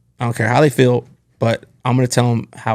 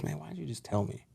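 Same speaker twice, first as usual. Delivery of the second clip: hushed, higher voice, imitating a hurt friend; retold tearful plea; 4 semitones higher, 19 dB quieter than usual. Fired friend asks his manager why he wasn't warned, hushed tearful imitation. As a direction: quote his hurt question very softly, pitched up. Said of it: "he's, like, in tears".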